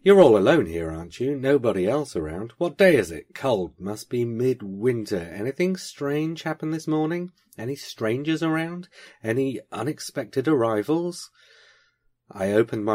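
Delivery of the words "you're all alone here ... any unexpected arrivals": put-on sharp interrogation of the girl; sharp questions. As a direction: fire questions at her. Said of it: "asked me sharply".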